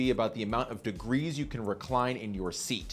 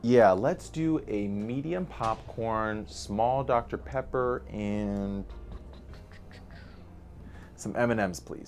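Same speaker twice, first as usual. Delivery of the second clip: slow ordering; 3 semitones lower, slower than usual. Acted. Slow deliberate order in skit.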